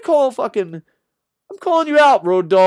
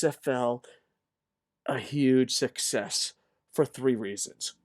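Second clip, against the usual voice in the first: mock prayer; 5 semitones lower, 7 dB quieter than usual. Host praying to Terry Funk, soft hushed reverent voice. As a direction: pray quietly with fake reverence.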